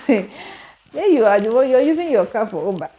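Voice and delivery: ordinary talk, nothing marked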